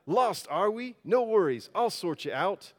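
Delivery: high, loud Aussie sat-nav; Australian accent. Acted. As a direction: chirp it out in hammed-up Australian. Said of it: "I'll do my best Australian accent".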